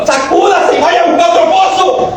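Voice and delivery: high shouted command; preacher's shout. Acted. Preacher shouting at the congregation to shake it off. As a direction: shout them awake.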